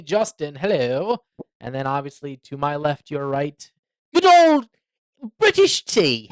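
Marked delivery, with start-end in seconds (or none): 4.1-6.3 high, loud hammed-up British accent; mock British accent. Host introducing his co-host as tea, mock British accent. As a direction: put on a silly British voice, stretched out.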